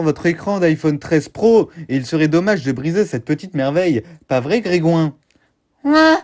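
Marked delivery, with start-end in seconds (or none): 5.8-6.2 put-on high wailing little kid; crying child. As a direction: sob to daddy like a small child.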